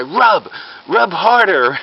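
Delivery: slow, high-pitched, emphatic order; mock urgent command. Fake doctor making a bystander rub his stomach, mock commanding. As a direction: draw out each command with urgency.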